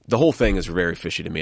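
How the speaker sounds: usual speaking voice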